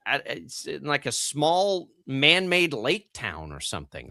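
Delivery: ordinary talk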